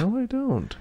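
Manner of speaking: high playful protest; mock innocent squeak; squeaky high denial.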